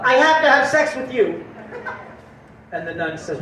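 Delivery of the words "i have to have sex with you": sudden higher-pitched blurt; blurted urge. Acted. Guy smitten by the gorgeous nun, urgent raised blurt. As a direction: burst out with it, overcome.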